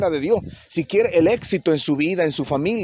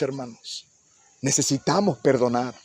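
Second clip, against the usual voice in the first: ordinary talk, nothing marked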